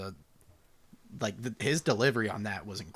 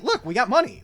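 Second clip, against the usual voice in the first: character voice; 12 semitones higher, 9 dB louder than usual. Retold or imitated. Quoting Zac Efron's character.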